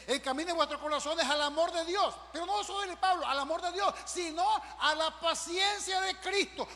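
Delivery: emphatic preacher voice, drawing out the last phrase; full-force preaching. Acted. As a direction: proclaim the verse from the pulpit. Preacher declaiming scripture.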